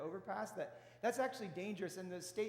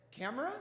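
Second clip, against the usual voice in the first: "Camera?" lifted at the end like a question; questioning rise; 4 semitones higher, 3 dB louder than usual. Retold or imitated. Husband checking with wife while packing, questioning rise. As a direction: ask "Camera?" with the voice going up. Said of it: "my voice went up at the end".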